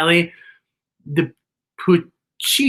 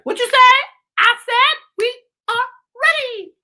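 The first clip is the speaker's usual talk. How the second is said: much higher, loud squeal; squeaky high voice; 4 dB louder than usual. Acted. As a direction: jump way up into a squeaky voice. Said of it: "What was that voice? It went really high".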